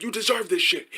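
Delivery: high-pitched imitated warning; mock heated argument, no real shouting; mock arguing. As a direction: warn someone in a put-on high angry voice. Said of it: "we were just going back and forth".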